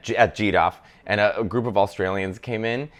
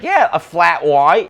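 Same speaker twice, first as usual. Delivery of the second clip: higher, louder, slow drawled Australian; mock Australian; 6 semitones higher, 6 dB louder, slower than usual. Australian tourists order in an Italian café, mock drawl. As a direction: ham up the Aussie tourist ordering. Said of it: "this group of Australians like".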